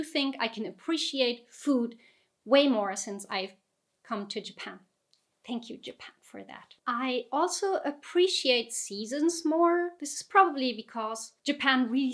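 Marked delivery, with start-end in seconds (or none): 5.5-6.7 a soft breathy aside, almost no voice; whispered thanks. Real, not acted. Vlogger whispering thanks to Japan for better taste, whispered. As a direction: whisper it like a private thank-you.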